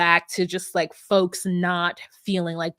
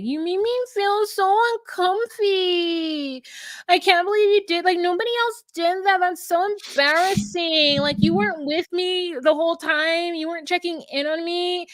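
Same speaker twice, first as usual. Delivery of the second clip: whiny, much higher mock voice with stretched words; Chelsea complaining; 8 semitones higher than usual. Chelsea complaining to Jimmy at the hotel, exaggerated whining. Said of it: "starts to complain about".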